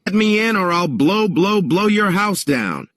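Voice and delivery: high-pitched threatening wolf; big bad wolf. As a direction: menace the pig from outside the door. Wolf threatens at pig's door.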